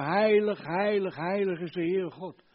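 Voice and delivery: calling out. Retold or imitated. Preacher voices angels praising God, calling out. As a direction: call it out like angels in worship. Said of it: "engelen die continu roepen".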